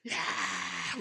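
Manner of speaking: long drawn-out holler; reenacted yell. Scolded boy yelling at the gate for neighbours to hear, shouting. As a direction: holler it out as if to the whole village. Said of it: "소리를 질렀어".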